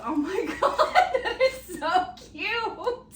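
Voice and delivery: laughing. Real, not acted. Laughing at the cute shirts.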